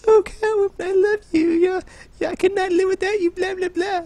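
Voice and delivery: high, gushing lovesick voice; mock a lovesick suitor; swooning lovestruck falsetto. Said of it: "it wasn't based on, like".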